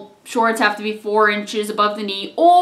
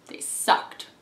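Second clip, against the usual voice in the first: under her breath, unvoiced; whispered gripe; whispered aside; 8 dB quieter than usual. Real, not acted. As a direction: whisper it like a confession. Aside about hated uniforms.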